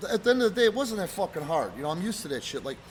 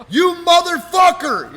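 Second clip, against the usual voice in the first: loud, high, drawn-out cursing; retold indignant outburst; 12 semitones higher, 14 dB louder, slower than usual. Wrestler answering his friend's insult, indignant shout. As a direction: draw out the curse at full volume.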